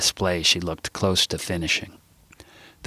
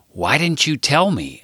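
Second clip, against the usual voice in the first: higher, pleading question; 8 semitones higher than usual. Acted. [name] pleads with Sarah, hurt and pleading. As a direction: beg her for an answer. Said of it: "I pleaded to Sarah".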